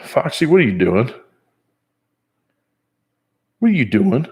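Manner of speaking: high, playful sing-song questioning; pet-talk voice. Real, not acted. Man asking Foxy what she's doing, high pet-talk voice.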